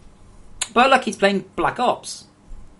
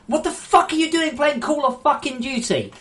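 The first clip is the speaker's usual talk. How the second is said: furious shout, much higher; pissed off; 10 semitones higher, 3 dB louder than usual. Real, not acted. Streamer yelling at a kid playing Call of Duty, furious. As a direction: yell it in real outrage. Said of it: "I'm pissed off".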